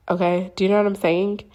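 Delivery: normal talking voice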